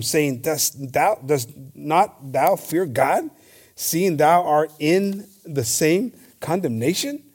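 slow, solemn Bible reading; preacher reading the Gospel aloud to the congregation, solemn and slow; scripture reading. Acted. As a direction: read the passage slowly, like from the pulpit. Reads the crucifixion scripture aloud.

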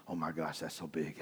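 low, soft marvelling; hushed awe. Retold or imitated. Hunter gawking at a giant deer, hushed awe. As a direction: marvel under your breath. Awed at deer while in stand.

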